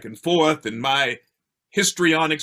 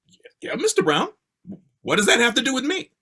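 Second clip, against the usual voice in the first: higher, quick voice of his younger self; puzzled schoolboy; 7 semitones higher, faster than usual. Retold or imitated. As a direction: play a boy questioning his teacher, pitched up. Retold boy answering his drama teacher.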